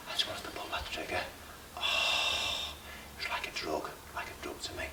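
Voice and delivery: soft, low whisper savouring the thrill; craving whisper. Acted. Gunman describing the thrill of shooting, craving whisper. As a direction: whisper it like an addict.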